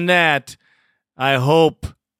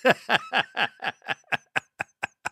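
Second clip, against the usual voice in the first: quiet real giggle; soft laugh; 5 semitones higher, 7 dB quieter than usual. Real, not acted. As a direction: chuckle after the joke. Host laughing after mock cry.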